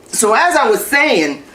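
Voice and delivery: strained, breathless mid-exercise; talking through sit-ups, straining; doing sit-ups. Real, not acted. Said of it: "I can't do this".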